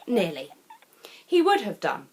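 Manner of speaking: ordinary delivery, nothing marked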